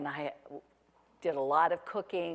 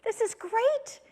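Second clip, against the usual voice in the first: high, cheery husband voice; chirpy reassuring husband, hammed-up; 12 semitones higher than usual. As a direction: play the delighted husband, pitched way up. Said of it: "And he said".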